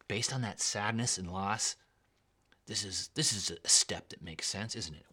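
soft, sighing, coaxing devil voice; tempting devil. Acted. Preacher voicing the devil tempting a man, soft and sighing. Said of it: "He takes the match of a lie and just says".

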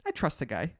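soft, low, unbothered quote; offhand retold reply. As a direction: imitate him brushing it off softly. Retelling partner's unworried reply.

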